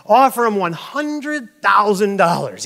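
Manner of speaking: loud, high, emphatic boss's order; acted exasperated supervisor, stretching out the offer; kinda angry. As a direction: raise the stakes in mock anger. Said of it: "now they're kinda angry".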